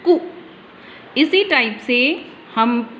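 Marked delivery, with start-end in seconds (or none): 0.1-0.2 कु said short and quick with a brief u. Shown as a demonstration. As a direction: clip the vowel in कु, say ku. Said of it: "जल्दी बोलेंगे".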